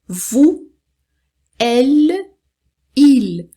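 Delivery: "Vous, elle, il" spaced out, clear final l; French pronoun model. Shown as a demonstration. Teacher showing plurals sound like singulars, careful pronunciation. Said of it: "Tem a mesma pronúncia".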